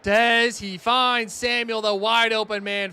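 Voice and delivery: hyped commentator calling a wide open catch; excited call. Real, not acted. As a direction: call the big completion out loud.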